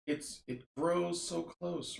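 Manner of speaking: understated frightened whimper, drawn out. Acted. Randy pressing close in the dark, mild whimper. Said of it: "Randy whimpers".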